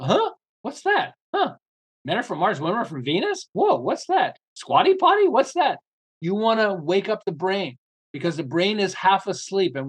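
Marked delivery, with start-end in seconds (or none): 0.0-5.8 high, jumpy, astonished "huh, what's that?"; startled curiosity. Acted. A shopper's brain jolted awake by a weird product, amazed. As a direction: snap awake at something odd.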